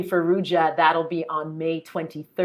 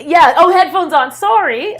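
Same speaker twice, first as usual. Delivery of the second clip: loud call from upstairs; yelling across the room; 9 semitones higher, 9 dB louder than usual. Real, not acted. Calling to husband in another room.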